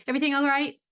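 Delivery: quick high. Shown as a demonstration. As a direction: fire it off high and bright. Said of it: "It's not gonna be".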